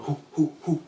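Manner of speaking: short loud boxer's hoots slapping gloves; hype grunts. Retold or imitated. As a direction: pump yourself up with sharp hoo sounds. Guy hyping up in backyard.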